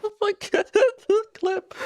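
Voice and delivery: high squeaky laughing words; helpless giggle in the voice; after laughing hard. Real, not acted. Laughing again at the clip.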